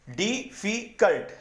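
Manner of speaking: "डिफिकल्ट" slowly as di, fi, cult; three spaced beats on "डिफिकल्ट"; broken into syllables. Shown as a demonstration. Teacher shows the word breaking in three, syllable by syllable. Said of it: "तीन में टूटा".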